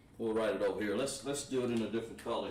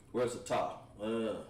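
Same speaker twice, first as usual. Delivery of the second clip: normal talking voice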